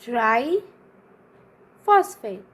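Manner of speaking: very slow, broken into separate parts; slow dictation. Real, not acted. Teacher writing out a term for students, slow dictation. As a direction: dictate the term piece by piece with long pauses.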